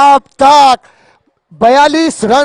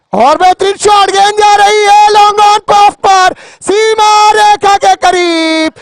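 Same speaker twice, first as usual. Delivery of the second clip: excited cricket commentary; 6 semitones higher than usual. Real, not acted. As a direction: call the shot as it flies. Big shot toward boundary.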